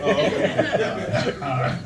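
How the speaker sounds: ordinary talk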